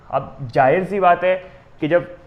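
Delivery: ordinary talk, nothing marked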